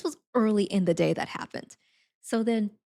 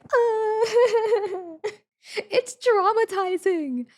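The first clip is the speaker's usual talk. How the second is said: high, drawn-out mock wail; hammed-up whimpering through giggles; mock traumatized; 12 semitones higher, much slower than usual. Reacting to the British man joke, mock crying.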